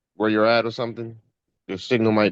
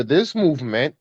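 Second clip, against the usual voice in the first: ordinary talk, nothing marked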